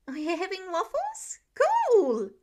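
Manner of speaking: put-on high, loud child voice, thrilled; a kid spotting waffles; excited little boy. Small boy excited about breakfast.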